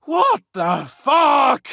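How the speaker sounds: angry outburst. Acted. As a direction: explode at the news. Outburst at bad news.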